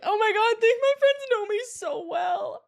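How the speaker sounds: high, loud, crying gush; overcome, wail it through happy tears; tearful. Retold or imitated. Friend opening a birthday gift, tearful and overwhelmed. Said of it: "I literally cried because I was like".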